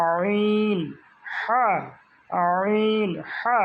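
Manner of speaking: "আইন, হা" held long, pressed from mid-throat; squeezed throat sounds. Shown as a demonstration. Teacher models mid-throat letters for students, squeezed and drawn out. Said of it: "আওয়াজকে এবার চাপ দিয়ে".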